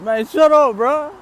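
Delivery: high, loud embarrassed yell; embarrassed shout. Retold or imitated. To the laughing women, a high embarrassed shout. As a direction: shout them down, half laughing. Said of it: "I was like".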